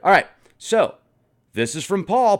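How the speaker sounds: ordinary delivery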